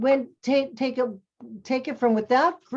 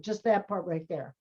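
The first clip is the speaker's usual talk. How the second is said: usual speaking voice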